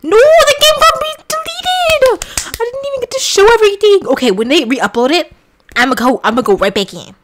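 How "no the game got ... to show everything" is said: high whine. Real, not acted. Game deleted, whining.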